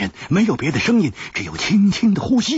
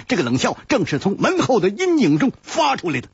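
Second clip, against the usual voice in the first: ordinary delivery, nothing marked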